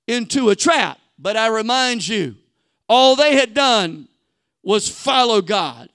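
preacher driving home the trap; building sermon. Acted. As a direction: preach it with rising force. Preaches at full force.

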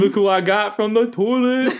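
high, loud buddy greeting; mock bragging ghost. Ghost showing Satan her catch, loud high brag. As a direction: show off a prize, loud and high.

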